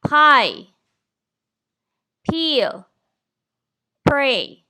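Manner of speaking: Pie, Peel, Pray, each with a loud, puffed P; strong P. Shown as a demonstration. Teacher showing the class the P sound, loud and puffed. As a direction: push a burst of air on every P. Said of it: "the sound of the letter P".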